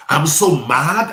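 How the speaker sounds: loud and slow, imitating a fed-up person; hammed-up fury at annoying people; mock angry outburst. Quoting an upset congregant.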